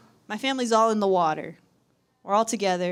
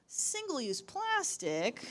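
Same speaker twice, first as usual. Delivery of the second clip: mock objection; 6 semitones higher, 8 dB quieter, much slower than usual. Voicing what the audience is thinking.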